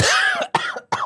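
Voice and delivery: high rasping real cough; real cough from a strained throat; coughing fit. Real, not acted. Singer with a sore throat coughing hard. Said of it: "singing is not a good idea".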